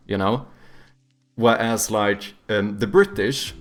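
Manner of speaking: normal talking voice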